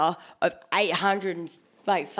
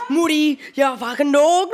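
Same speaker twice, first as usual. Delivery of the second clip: loud, high, drawn-out mock tirade; imitated cursing; 6 semitones higher, 9 dB louder than usual. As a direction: act out someone cursing, stretched and loud. Imitated cursing at slot machine.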